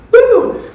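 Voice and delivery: high, loud "woo"; celebratory whoop. Acted. Speaker celebrating five wins, whooping. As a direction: let out a sudden cheer.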